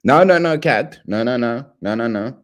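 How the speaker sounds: teasing no-no-no, pitch up; mock scolding; playful high protest. Real, not acted. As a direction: scold a viewer in jest.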